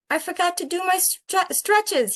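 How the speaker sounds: put-on a little boy inventing an excuse; boy's excuse voice. Jabari to his dad, high childish stalling.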